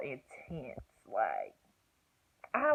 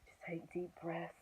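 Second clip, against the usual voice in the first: whispered, soothing coaching; cool calm mom; 14 dB quieter than usual. Retold or imitated. Mom coaching her through labor, calm whisper. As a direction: imitate a hushed, composed reassurance. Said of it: "like, so cool".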